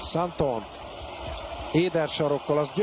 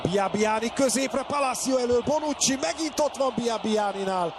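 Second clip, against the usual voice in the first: rising, higher calls; building excitement; 7 semitones higher than usual. Real, not acted. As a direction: build up with each name. Attack builds in play-by-play.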